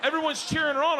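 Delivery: usual speaking voice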